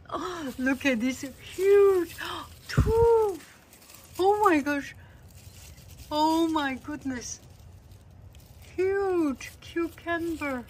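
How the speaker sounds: high, loud cries of amazement; excited delight. Real, not acted. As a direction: exclaim over the huge find. Finding huge cucumbers.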